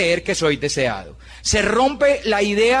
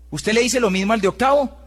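ordinary talk

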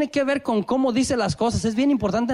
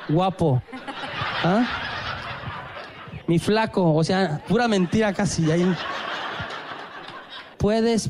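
sweet coaxing; 4 semitones lower than usual. Retold or imitated. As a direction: coax him sweetly, drawing out the pet names. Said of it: "Pero Alba me soba la manita".

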